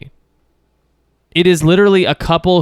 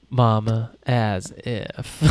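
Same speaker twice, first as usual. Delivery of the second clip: lightly imitated Southern drawl, low and soft; deep Southern accent; 3 semitones lower, 7 dB quieter, much slower than usual. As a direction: mimic her Southern line, trailing off quieter. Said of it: "line in her deep Southern accent".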